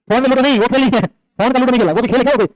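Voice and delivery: mock-exasperated scolding. Real, not acted. Teacher objects to phone Ludo counting as sport, exasperated scolding.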